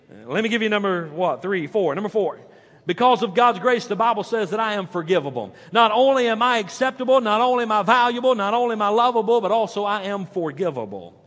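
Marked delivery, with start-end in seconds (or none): 2.9-11.2 building preacher's cadence, louder and higher; preaching. Acted. Preacher calling out to the congregation, building. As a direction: drive the point home from the pulpit.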